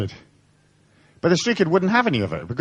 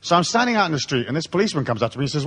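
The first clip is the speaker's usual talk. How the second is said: ordinary delivery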